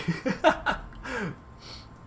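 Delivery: high, breaking-up laugh; real high-pitched laughing fit; amused laugh. Real, not acted. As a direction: burst out laughing mid-thought. Amused by the item's wording.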